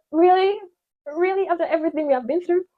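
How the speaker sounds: high, loud mock-wounded outrage; forehead's indignant voice. Her forehead scolding her for forgetting it, high and loud in mock hurt. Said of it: "My forehead is like".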